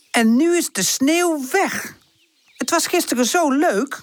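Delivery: put-on high-pitched childish lament; disappointed. A narrator's reading. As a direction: wail it high, sorely let down. Children lamenting the melted snow. (hoog, beteuterd jammeren)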